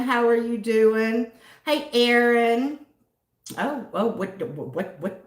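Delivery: ordinary delivery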